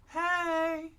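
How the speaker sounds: loud high hail; a woman on the road hailing the cart, loud and high; calling out. Acted. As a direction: shout a greeting from up ahead.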